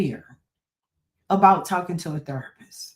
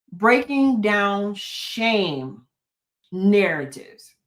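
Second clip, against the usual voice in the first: slow, deliberate; much slower than usual. Shown as a demonstration. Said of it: "I can say that over and over again".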